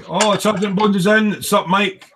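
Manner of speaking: bright high call, Sergeant Bones is in; excited greeting. Real, not acted. Host cheerfully greeting a viewer who just joined, excited. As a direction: announce the arrival with a lift.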